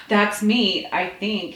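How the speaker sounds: plain reading voice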